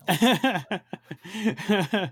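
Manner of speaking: laughing while talking. Real, not acted. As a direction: say it through a real laugh. Laughs at own joke.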